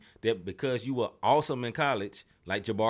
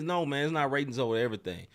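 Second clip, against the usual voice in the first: ordinary delivery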